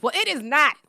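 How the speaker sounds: high, loud reveal; loud and bright. Real, not acted. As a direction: break out of the act loudly. Breaking the serious act.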